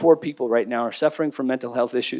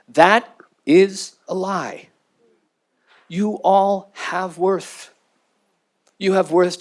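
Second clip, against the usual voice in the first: slow, deliberate, sincere insistence; heartfelt, slow reassurance; sincere appeal; 3 semitones higher, much slower than usual. Real, not acted. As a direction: land every word slowly for the room. Emphatic plea to audience.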